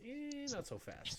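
high, drawn-out "eh"; voicing the cautious inner skeptic; playful objection. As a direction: squeak out a slow, doubtful objection. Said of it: "the data side of me says".

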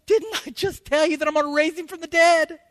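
high, mildly exasperated rebuke; a touch of a tired teacher; exasperated Jesus. Retold or imitated. Jesus gently scolding Martha, high and exasperated. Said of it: "Jesus is going".

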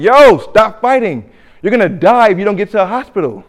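high, loud, urgent shout to stop; loud urgent warning; clerk yelling. Retold or imitated. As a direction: yell at them to quit fighting before he bleeds out. Clerk yelling at bleeding fighter.

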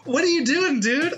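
put-on high, amused disbelief at the baby; incredulous dude.

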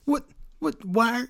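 high-pitched imitation of a flustered wrestler; bewildered protest. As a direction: plead innocence in a put-on high voice. Acted bewildered reply to yelling.

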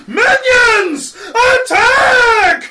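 drawn-out high, loud scream; rally the minions at full yell; high-pitched war cry; yelled battle command. Acted. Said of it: "I yell out".

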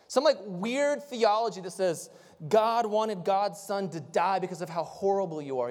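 usual speaking voice

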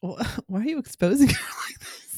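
high, giggly mock reproach; laughing protest. Real, not acted. Co-host objecting to exposing the daughter, laughing. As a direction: protest through real laughter.

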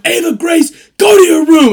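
loud, high shouted command; dad yelling the child to her room; angry yell. Retold or imitated. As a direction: bellow at the kid like a drill instructor. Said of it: "I don't usually yell that loud".

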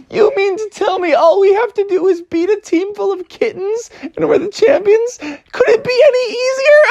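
high voice breaking into laughter; laughing scornfully. Acted. Froggy mocking the kittens, high laughing voice. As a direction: sneer through the laughs. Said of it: "he started laughing and laughing".

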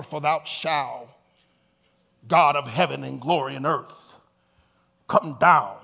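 low, pompous King James praying; mock proper prayer. Preacher imitates educated show-offs praying, pompous and proper. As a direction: pray grandly and low, showing off. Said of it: "you educated and you're proper".

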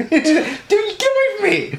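high, drawn-out mock protest; laughing high-pitched playful protest; mock recoil. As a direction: squeal it slowly through laughter, fending off a kiss.

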